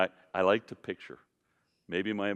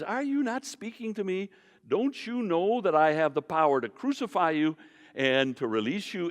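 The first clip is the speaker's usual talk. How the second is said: raised-pitch, lightly voiced irritated Pilate; a hint of an exasperated governor pressing Jesus; frustrated Pilate; 8 semitones higher than usual. Preacher voicing Pilate demanding an answer from Jesus. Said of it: "Pilate gets frustrated".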